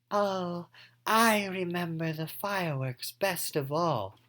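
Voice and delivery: lightly voiced wistful girl; Anne Marie voice. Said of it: "a voice for each person".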